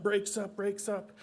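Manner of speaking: ordinary delivery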